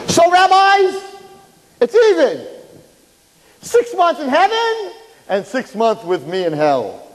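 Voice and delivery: pushy dark angel's high voice. Retold or imitated.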